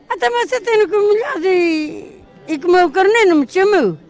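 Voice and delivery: high, whiny fox character; sly fox voice. Acted. Fox voiced in folk tale.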